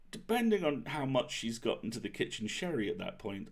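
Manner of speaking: usual speaking voice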